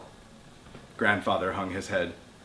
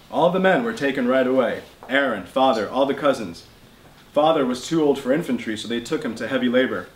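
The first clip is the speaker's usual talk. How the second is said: ordinary delivery, nothing marked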